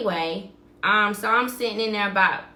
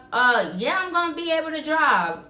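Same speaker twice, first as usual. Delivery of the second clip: higher, hurried claim she can drive; telling the supervisor she is fine to drive; retold flustered reassurance; 7 semitones higher than usual. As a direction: brush off the worry in a raised voice. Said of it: "Are you gonna be able to drive?".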